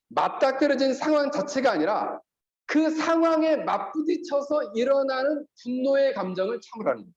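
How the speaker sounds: usual speaking voice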